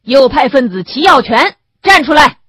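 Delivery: loud, high, official roll-call shout; stern public order at full volume; shouted command. A narrator's reading. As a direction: summon the accused in front of a crowd. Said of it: "赵正芳喊".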